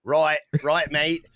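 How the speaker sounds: a loose Aussie-British mate voice; mock British accent. Friend Yorkie texting about wrestling, in a mock British accent. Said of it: "And he's like".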